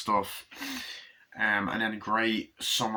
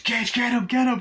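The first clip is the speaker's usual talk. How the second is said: much higher and louder frantic shout; panicked yell; 8 dB louder than usual. Retold or imitated. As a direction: scream it as the truck comes. Retelling dad panicking at truck.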